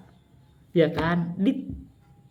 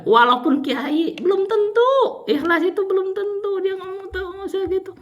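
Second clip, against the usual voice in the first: much higher, slow and drawn-out, mocking the kiai; mock preacher voice; 12 semitones higher than usual. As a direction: lift the voice high and stretch it in mockery.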